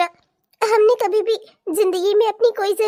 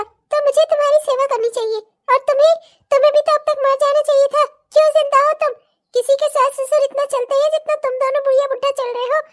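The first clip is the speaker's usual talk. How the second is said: furious yelling; 4 semitones higher, faster than usual. Acted. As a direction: spit the words at them in rage. Daughter-in-law yells at old in-laws.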